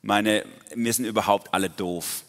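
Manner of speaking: hammed-up reluctant complaint; exasperated Gideon. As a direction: act out a reluctant man objecting yet again. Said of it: "zum x-ten Mal so".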